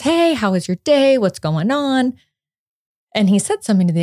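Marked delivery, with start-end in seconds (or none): 0.0-2.1 casual check-in. Retold or imitated. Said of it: "very casual check-in".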